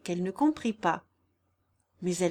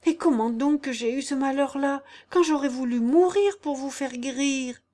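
higher, louder, distressed plea; appalled protest; 6 semitones higher, 6 dB louder than usual. A narrator's reading. Jeanne defending herself to her godfather, appalled. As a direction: protest your devotion in dismay. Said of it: "dit Jeanne tout épouvantée".

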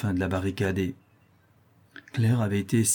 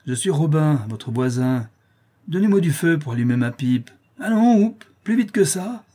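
put-on coarse drunk neighbour, higher and louder, bullying; bellowing lout demanding a light for his pipe; drunken brute; 7 semitones higher, 7 dB louder than usual.

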